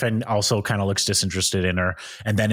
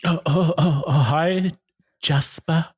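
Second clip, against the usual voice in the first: higher, flustered lovestruck woman; Iris; 5 semitones higher, slower than usual. Acted. Iris answers Jasper's greeting, higher flustered stammer. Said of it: "you be Kate Iris still".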